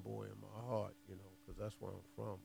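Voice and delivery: fading away to a mumble; trailing off. Real, not acted. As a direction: let the voice drop off at the end. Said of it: "Trailed off".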